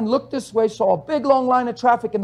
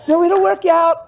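mock forced cheer; 8 semitones higher, 3 dB louder than usual.

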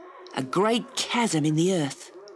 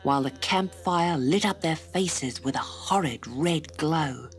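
ordinary delivery, nothing marked

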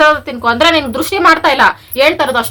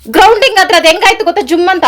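high, loud voice of an aggrieved wife; raised-pitch tirade; indignant scolding; 6 semitones higher, 6 dB louder than usual. Real, not acted. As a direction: tell your husband off loudly. Wife complains how the shock felt.

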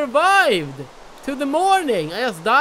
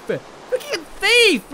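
outraged; 9 semitones higher, much slower than usual. Real, not acted. Outraged at a thief in game.

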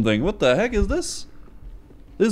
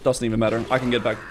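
usual speaking voice

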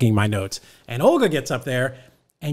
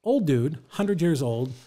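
normal talking voice

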